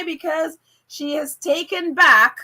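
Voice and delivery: normal talking voice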